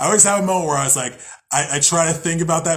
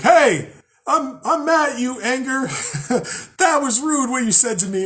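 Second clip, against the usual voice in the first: mock scolding the anger; 10 semitones higher, 3 dB louder than usual. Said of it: "hold energy like a bottle and be like".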